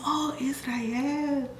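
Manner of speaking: very slow, soft whisper of the name; whispered nurse. Retold or imitated. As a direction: breathe the name gently. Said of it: "She goes".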